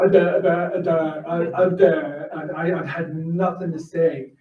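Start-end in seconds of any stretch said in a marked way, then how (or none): 0.0-2.3 mock stammering, lost for words; hammed-up tongue-tied stammer; flustered stammer. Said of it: "you just see me go".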